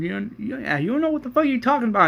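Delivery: high-pitched angry outburst, rattled off fast; mock-yell it back at him; retold scream. Daryl yelling at Shane in a fast, high angry outburst. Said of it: "Daryl screamed".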